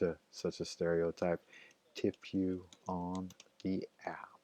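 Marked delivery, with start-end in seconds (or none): 1.9-4.4 hushed musing. Real, not acted. Driver wryly recalling a passenger's empty promise, slow and hushed. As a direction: repeat it slowly under your breath.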